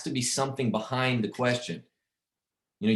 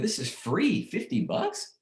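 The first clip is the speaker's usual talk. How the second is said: high-pitched, amazed questioning; disbelieving, sharply rising pitch; incredulous; 12 semitones higher, slower than usual. Retold or imitated. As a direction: marvel at how cheap it is, pitch leaping up. Reacting in disbelief to low price.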